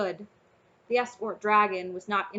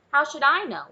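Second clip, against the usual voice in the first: louder, higher answer over the din; loud retort; 5 semitones higher, 8 dB louder than usual. Acted. A dragon answers loudly from the cheering crowd. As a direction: snap it back over the crowd.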